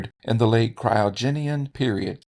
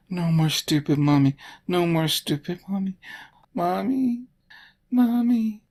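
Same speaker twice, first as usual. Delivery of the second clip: put-on whiny child; 6 semitones higher than usual. Mocking whiny child chant.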